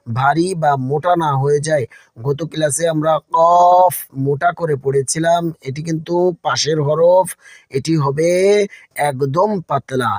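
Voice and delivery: ordinary talk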